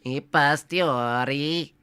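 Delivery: put-on slow, hammy Italian accent on the Latin; stretched pasta-chef Italian accent; mock Italian accent. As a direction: say it like a stage Italian, drawn out. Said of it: "in an Italian accent".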